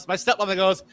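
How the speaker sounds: usual speaking voice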